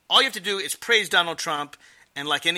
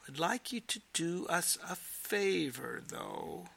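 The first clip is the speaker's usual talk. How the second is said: softer and slow, letting it hang; pointed soft repeat; 2 semitones higher, 9 dB quieter, slower than usual. Retold or imitated. Repeating Trump's request to Zelensky, soft and slow. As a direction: lean in quietly so the favor lands as pressure.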